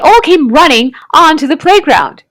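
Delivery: usual speaking voice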